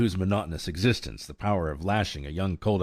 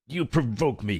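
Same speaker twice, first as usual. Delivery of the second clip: provoked cry; 6 semitones higher than usual. A narrator's reading. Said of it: "cried Firefly".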